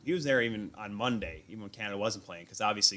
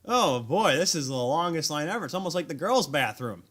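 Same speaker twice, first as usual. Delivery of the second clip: louder, higher brash loudmouth griping about the line; a fan griping to a stranger in the bathroom line, brash and loud; hot-headed loudmouth; 5 semitones higher, 5 dB louder than usual. Retold or imitated. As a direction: play the obnoxious guy in the queue, boldly. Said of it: "like some dumb, hot-headed idiot".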